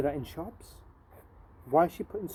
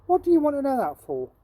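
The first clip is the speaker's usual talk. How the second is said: surprised; 10 semitones higher, 7 dB louder, faster than usual. Acted. Jim answers Barney, surprised.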